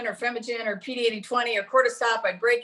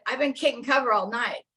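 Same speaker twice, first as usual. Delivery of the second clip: put-on repeating the line in a fuller Texas accent; leaning into the Texas twang of the patient; 2 semitones higher than usual. Patient repeats herself to a puzzled clinician in a Texas accent. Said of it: "she had this great Texas accent".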